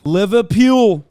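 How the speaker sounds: loud, slow hammed-up "Liverpool" in Scouse; Scouse accent. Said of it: "I was thinking Liverpool".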